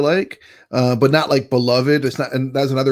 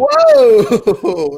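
stunned whoa; 7 dB louder than usual. Real, not acted. Shocked by a harsh review.

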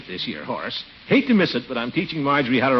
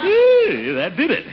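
high loud cry of relief; triumphant; 6 dB louder, slower than usual. Acted. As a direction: yelp of victory over the breeches. Finally gets the pants on.

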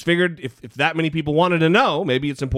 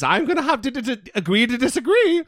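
a lightly put-on British accent, higher and stammered; mock the caller with a clumsy British accent; bad British accent; 8 semitones higher than usual. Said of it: "that sounded really lame".

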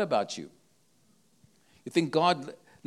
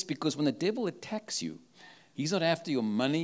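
usual speaking voice

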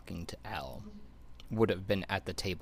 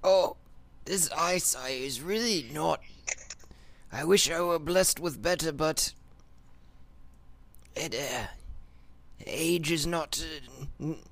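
put-on grumpy old codger, barking questions; old prospector; 9 semitones higher than usual. GM voices old hermit.

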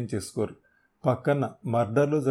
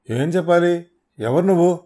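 high, loud frightened demand; panicked outcry; 6 semitones higher, 8 dB louder than usual. Acted. Bleeding thug facing his captor, loud panicked outcry. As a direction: cry out in panic.

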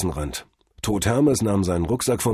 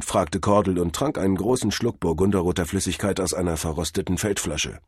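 plain reading voice

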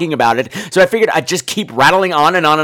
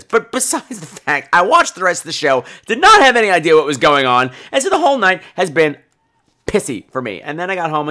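ordinary talk

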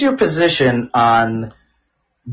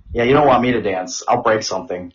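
normal talking voice

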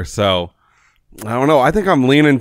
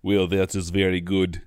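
put-on Russian accent; 6 semitones lower, slower than usual. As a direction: answer with a mock Russian accent. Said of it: "He's Russian next line or two".

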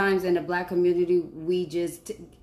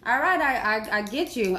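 ordinary delivery

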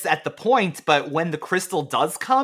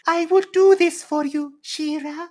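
high, put-on French accent as Mermista; sweet French-accented mermaid promising help; 12 semitones higher than usual. Playing Mermista to She-Ra in a high French accent. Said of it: "She has a French accent".

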